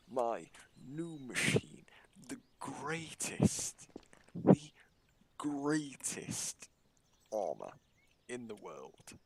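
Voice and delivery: slow, drawn-out, high-pitched hype; mock-grand build-up; grandiose boast. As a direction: stretch each word out like a grand unveiling. Grandly hyping his machine before the test.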